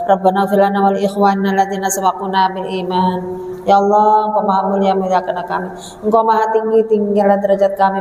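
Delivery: steady, monotone supplication; leading prayer. Real, not acted. Preacher asking God to protect the congregation, praying. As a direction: intone the dua evenly, phrase after phrase.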